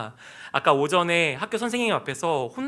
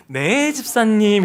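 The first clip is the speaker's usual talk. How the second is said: loud, drawn-out mock-sweet phone answer; mother's fake-sweet phone voice; 3 semitones higher, 6 dB louder, much slower than usual. Imitating mother answering the phone mid-scolding, fake-sweet. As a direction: switch to exaggerated politeness, slowly. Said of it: "막 저를 혼내다가도".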